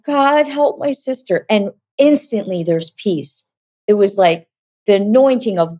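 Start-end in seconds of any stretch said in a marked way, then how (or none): 0.0-1.4 put-on child's simple prayer. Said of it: "praying the most simple prayer".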